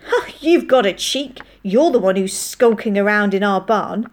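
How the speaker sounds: loud, indignant scolding; outraged. A narrator's reading. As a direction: round on the intruder, affronted. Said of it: "gave an outraged exclamation".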